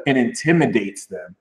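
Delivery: ordinary talk, nothing marked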